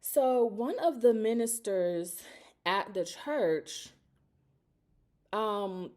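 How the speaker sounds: ordinary delivery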